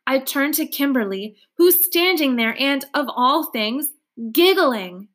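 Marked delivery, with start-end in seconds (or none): none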